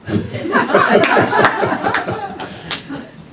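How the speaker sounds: a high, airy burst of real laughter. Real, not acted. After saying it was hell.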